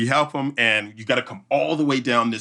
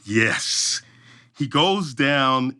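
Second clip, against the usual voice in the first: usual speaking voice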